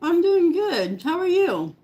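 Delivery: slow, chewing while talking; mouth full. Real, not acted. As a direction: answer through a mouthful. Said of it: "the food in my mouth".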